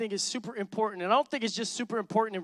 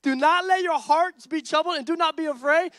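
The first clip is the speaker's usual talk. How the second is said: higher and louder, pressing; fiery repeat; 6 semitones higher, 6 dB louder than usual. Real, not acted. Pastor challenging fearful followers, higher and louder. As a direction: hit it harder the second time. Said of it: "Where my Jesus followers been".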